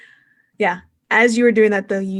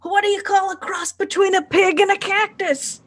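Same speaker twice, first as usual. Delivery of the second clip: high-pitched comic ring performer; corny showman; 12 semitones higher than usual. Acted. Game master plays a pigman joking during the fight, high hammy showman. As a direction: set up the joke in a big, high showman voice.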